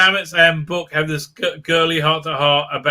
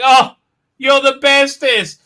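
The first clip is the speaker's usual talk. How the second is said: mock gushing crew; 7 semitones higher, 4 dB louder than usual. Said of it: "And they're like".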